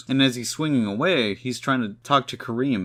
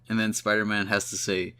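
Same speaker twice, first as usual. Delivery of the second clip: ordinary talk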